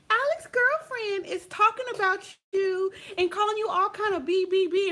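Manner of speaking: high, eager tattletale voice; mock the girl running to tell her; lightly voiced nosy schoolmate; gossiping schoolmate.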